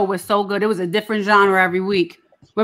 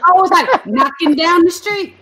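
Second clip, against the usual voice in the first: excited; 8 semitones higher, 4 dB louder than usual. Retold or imitated. Mock singing scary scene.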